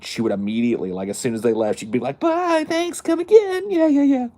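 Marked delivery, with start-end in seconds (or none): 2.2-4.3 very high, loud singsong farewell; fake nice goodbye. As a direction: chirp it like you can't wait for them to leave. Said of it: "over the top, smiley".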